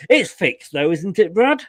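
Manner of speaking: ordinary delivery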